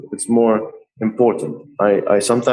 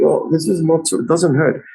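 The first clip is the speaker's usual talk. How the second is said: imitated eager client, higher pitch; keen surprise; 7 semitones higher than usual. Artist quoting young man wanting more tattoos, eagerly surprised. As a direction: mimic a newly converted customer.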